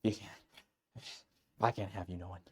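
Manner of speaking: low, quiet menacing yandere; creepy yandere. Pretend. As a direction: murmur it like a secret threat. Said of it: "Let's do yandere".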